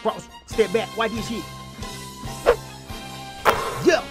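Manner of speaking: ordinary talk, nothing marked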